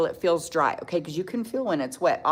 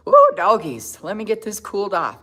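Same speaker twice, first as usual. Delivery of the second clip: loud, high ooh doggies; laughing through happy tears; overwhelmed exclamation; 4 semitones higher, 8 dB louder than usual. Real, not acted. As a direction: blow off emotion with a whoop. Overcome, fanning tears away.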